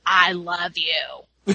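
low, slow hammed-up declaration; mock love confession. He confesses to her mid-explanation, mock-romantic.

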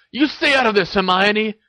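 sharp, high angry rebuke; snapped. A narrator's reading. Ron tells Hermione to stay out, snapping. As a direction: snap at someone butting in. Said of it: "snapped Ron".

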